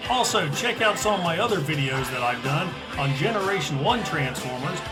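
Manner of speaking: quick, upbeat channel outro read; outro announcement. Acted. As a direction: read it like a radio sign-off. Recorded outro over music.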